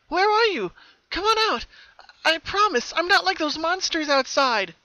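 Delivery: high and loud, coaxing; eager calling. Acted. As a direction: call into the dark room, hopeful. Pinkie calls to hidden pony.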